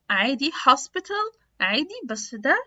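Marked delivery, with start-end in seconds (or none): 0.5-1.4 higher, slow British "hospital" with real T; British clear T. Shown as a demonstration. Teacher contrasting with American D, British clear T. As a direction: say hospital as "hos-pi-tal". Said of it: "في ال British accent".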